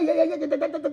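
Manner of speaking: rapid-fire mock jabbering; too-fast talk. Shown as a demonstration. Coach showing how not to communicate, absurdly fast chatter. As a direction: rattle it off like a babbling motormouth. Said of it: "how fast you talk".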